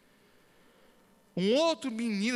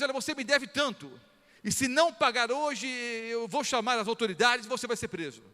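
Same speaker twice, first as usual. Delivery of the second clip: raised, loud demanding threat; harsh threat; 6 semitones higher, 3 dB louder than usual. Retold or imitated. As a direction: lean on the poor friend hard. Servant threatening debtor friend.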